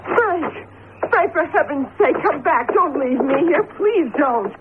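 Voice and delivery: high, pleading cries after him; frightened calling. Acted. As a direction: beg him not to leave her alone. Gloria calls Frank back, frightened.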